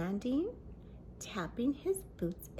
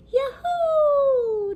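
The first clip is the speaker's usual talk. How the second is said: much higher, much louder whoop; yelling; 14 dB louder than usual. A narrator's reading. Girl whoops racing downhill, very high and loud. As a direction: scream it with pure delight. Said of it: "Nikki yelled again".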